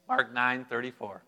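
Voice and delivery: ordinary talk, nothing marked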